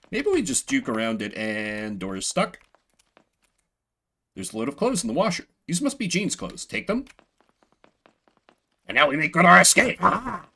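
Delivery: normal talking voice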